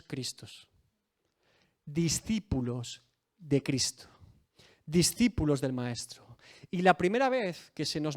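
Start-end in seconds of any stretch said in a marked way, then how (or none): none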